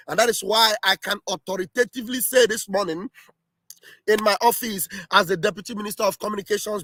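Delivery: usual speaking voice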